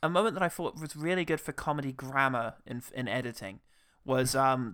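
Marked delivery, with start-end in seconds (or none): none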